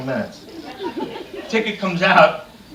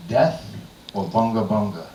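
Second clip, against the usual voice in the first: chief; 8 semitones lower, 3 dB quieter than usual. Retold or imitated. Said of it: "the chief says".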